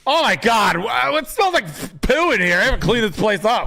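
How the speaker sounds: realtor impression. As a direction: squeal it like the fussy realtor. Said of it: "he talks like this".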